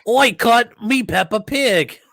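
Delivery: loud, high, drawn-out cartoon British; mock British accent. Joking about kids copying Peppa Pig, loud mock British accent. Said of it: "kids started having British accents".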